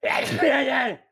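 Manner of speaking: loud sudden sneeze; sneezing. Real, not acted. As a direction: sneeze mid-word. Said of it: "The fuck was that?".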